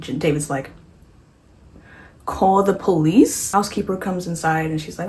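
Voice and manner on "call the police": slow, rising question; drawn-out questioning advice. Retold or imitated. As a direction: stretch it out and lift the end like a question. David repeats his advice to Jesse.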